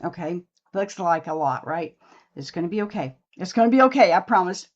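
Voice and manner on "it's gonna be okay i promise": louder, higher reassurance; reassuring. Real, not acted. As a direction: promise it brightly and firmly. Reassuring viewers about the look.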